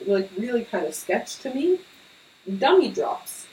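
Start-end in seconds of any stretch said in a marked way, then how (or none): 2.6-3.5 dramatic announcer. Acted. Announcing theory title dramatically.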